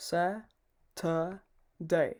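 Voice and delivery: Saturday drawn out syllable by syllable; slow Saturday. Shown as a demonstration. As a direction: stretch Saturday very slowly, sa-ter-day. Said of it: "যেটা ইংলিশে বলে".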